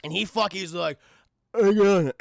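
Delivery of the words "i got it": loud, garbled action-hero impression; Sylvester Stallone. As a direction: bellow it almost unintelligibly. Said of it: "it sounded like".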